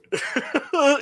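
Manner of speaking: squeaky amused laugh; high laugh. Real, not acted. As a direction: laugh at yourself. Laughs at own impression.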